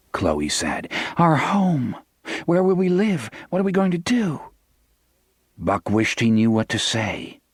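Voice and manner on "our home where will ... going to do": high, worried pleading questions; frightened wife. A narrator's reading. Wife asking her husband where they will live, frightened. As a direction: ask as if the ground just fell away.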